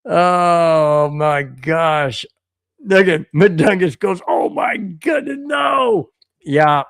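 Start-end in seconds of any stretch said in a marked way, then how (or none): 4.3-6.1 high, hammed-up exclamation; pitched-up dramatic outburst; mock horrified cry. Voicing a horrified viewer's chat message.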